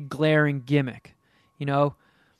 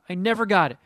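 normal talking voice

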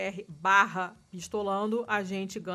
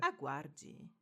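customer service voice; 4 semitones lower, 9 dB quieter than usual. Pretend. Mock phone queue recording.

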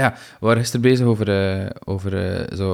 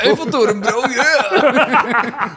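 excited shout; 11 semitones higher, much slower than usual. Real, not acted. Cheering about the Eiffel tower joke.

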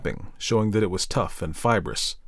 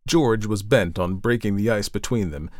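ordinary delivery

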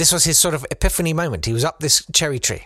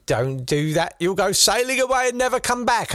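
worried mum voice; 9 semitones higher than usual. Retold or imitated.

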